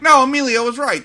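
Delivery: loud, high insistent line. Retold or imitated. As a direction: insist on it loudly. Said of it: "the movie at the end's like".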